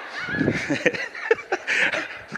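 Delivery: high breathy burst of laughter. Real, not acted. Pastor laughing at his own distraction, high burst of laughter.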